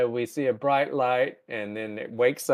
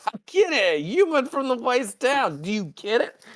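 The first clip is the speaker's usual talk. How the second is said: high, pleased-with-himself joke; mock goofy joker; 11 semitones higher than usual. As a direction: nudge them till they laugh. Spock pushing a crude joke.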